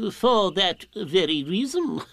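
slow, ponderous owl voice with hooting chuckle; pompous owl covering for not knowing. A narrator's reading. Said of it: "said Owl".

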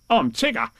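exuberant storybook tiger; Tigger. A narrator's reading. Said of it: "said Tigger".

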